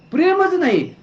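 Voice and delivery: scornful exclamation. Real, not acted. Preacher repeats scorn higher.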